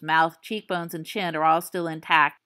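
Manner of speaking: ordinary delivery, nothing marked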